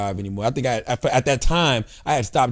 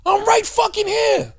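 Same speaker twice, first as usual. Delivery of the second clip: high, slow shout; yelling at mom; slower than usual. Retold or imitated. Son shouting at his mom who can't see him. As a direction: shout it so she finally hears you. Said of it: "I had to go up to my mom and grab her".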